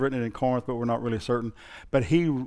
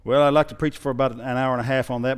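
ordinary talk

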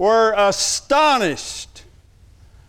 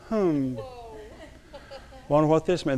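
contemplative; 7 dB quieter than usual. Retold or imitated. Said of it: "That's contemplative".

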